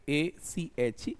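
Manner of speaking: letters spelt out slowly; slow spelling. Shown as a demonstration. Teacher spelling a word for students, slowly. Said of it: "एक word है एक".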